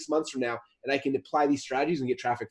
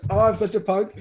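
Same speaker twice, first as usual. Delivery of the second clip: bursts out laughing, then giggly and higher; 8 semitones higher, 4 dB louder, much slower than usual. Real, not acted. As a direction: laugh off the guilt of the prank. Caught pranking the guest.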